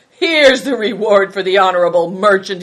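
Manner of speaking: bold, mocking defiance; triumphant cry. A narrator's reading. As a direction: cry it out as a threat. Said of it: "he cried".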